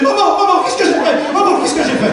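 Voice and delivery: half-laughing mock alarm; panicked son.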